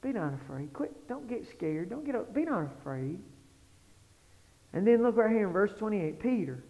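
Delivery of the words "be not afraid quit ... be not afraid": hushed, mostly low, soothing voice; soft reassurance. Retold or imitated. Jesus telling his followers not to fear, soft reassurance. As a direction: quietly settle frightened followers. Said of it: "He's always telling us".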